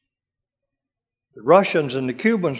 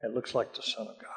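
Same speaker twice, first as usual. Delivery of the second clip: awed hush; 3 semitones lower, 11 dB quieter than usual. Retold or imitated. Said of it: "He said".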